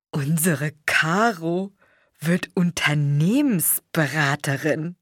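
slow, savouring proud announcement; beaming father. A narrator's reading. Father beaming about his daughter's new job, slow proud. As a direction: taste every syllable with pride.

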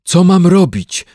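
high, loud bear's call; shouted question. A narrator's reading. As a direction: shout it across the boat. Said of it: "zawołał niedźwiadek".

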